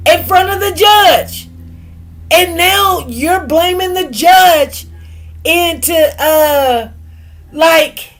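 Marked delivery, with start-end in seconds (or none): none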